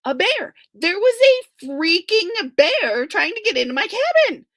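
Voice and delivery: high, loud panicked gasp of news. A narrator's reading. Narrator panicking about a bear at her cabin. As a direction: blurt it out in a panic.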